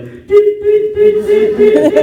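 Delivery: high loud beeping; ticking beeps. Retold or imitated. Imitating a ticking bomb beep, high and loud. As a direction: beep like a countdown device. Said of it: "a ticking thing going".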